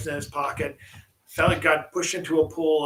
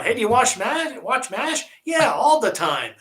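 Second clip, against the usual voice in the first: higher, louder corny joke setup; old sitcom joke voice; 7 semitones higher, 5 dB louder than usual. Retold or imitated. Telling a fifties joke to the co-host, hammed-up corny delivery. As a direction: ham it up like a fifties comic. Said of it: "like the jokes from the fifties".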